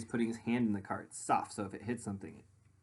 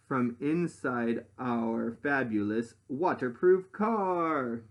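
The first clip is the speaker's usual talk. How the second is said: lively rhyming storybook read; reading to children; 4 semitones higher, 6 dB louder than usual. Acted. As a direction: read it out to the class with bounce. Reads picture book aloud.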